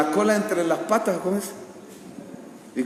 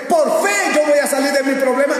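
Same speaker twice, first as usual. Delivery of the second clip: high, loud cry of faith; hammed-up congregational confidence; mock fervent declaration; 9 semitones higher, 6 dB louder than usual. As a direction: shout it like a zealous believer. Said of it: "si todos los demás dicen".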